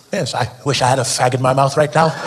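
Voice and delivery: a genteel Brit pining for a cigarette; British accent. Retold or imitated. UK smoker longing for a cigarette, British accent. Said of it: "in the UK".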